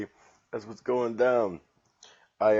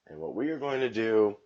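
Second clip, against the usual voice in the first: ordinary talk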